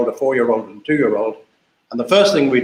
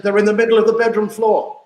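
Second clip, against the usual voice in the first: put-on high-pitched little boy voice, matter-of-fact; the son innocently tells mum where the trousers are; child's answer; 8 semitones higher than usual. Son answering his mum about trousers in a light high child voice.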